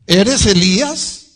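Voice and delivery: loud, slow, high-pitched question; questioners pressing. Acted. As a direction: fire the question out loudly, drawn out.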